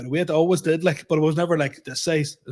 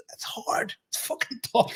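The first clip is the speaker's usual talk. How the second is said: whisper; 7 semitones higher, 7 dB quieter than usual. Real, not acted. Confiding struggle at gym.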